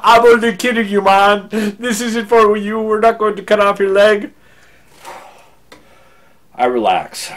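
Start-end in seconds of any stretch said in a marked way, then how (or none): 0.0-4.3 very loud, high put-on nurse voice; hammed-up imitation of a nurse roaring at a joke; laughing nurse's voice. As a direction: bellow the joke in character. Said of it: "then she starts laughing".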